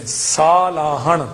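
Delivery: ਸਾਲਾਹਣ drawn out slowly, long saa; long first vowel. Shown as a demonstration. Teacher correcting the common ਸਲਾਹਣ to ਸਾਲਾਹਣ. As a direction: stretch the kanna in ਸਾਲਾਹਣ. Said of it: "ਬਹੁਤਿਆਂ ਦਾ ਦੇਖਿਆ ਕਿ ਸਲਾਹਣ".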